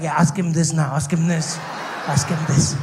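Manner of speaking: loud, insistent lightly voiced shark; egging-on shark. Shark puts babe up to questions, loud mock urging. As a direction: goad them on, repeating it firmly. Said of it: "It's almost like there is a shark".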